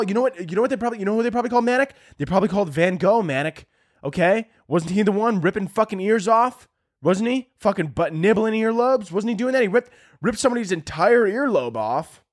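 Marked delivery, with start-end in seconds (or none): none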